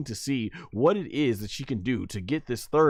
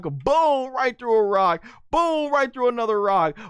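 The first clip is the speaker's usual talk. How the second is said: high booming call on each impact; escalating fight commentary; excited play-by-play; 11 semitones higher than usual. Real, not acted. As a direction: punch out every boom like a fresh hit. Play-by-play of crashing through rocks.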